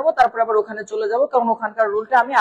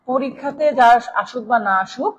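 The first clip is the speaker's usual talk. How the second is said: ordinary delivery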